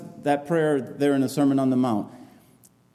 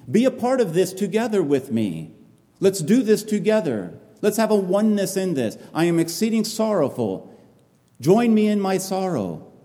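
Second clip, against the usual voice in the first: pleading Jesus; 7 semitones higher than usual. Acted. Jesus pleading with his disciples.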